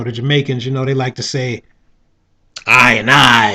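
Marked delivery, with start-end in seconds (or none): 2.7-3.5 loud, low Jamaican "I and I"; a mock Jamaican shout; Jamaican accent. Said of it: "that's my Jamaican accent".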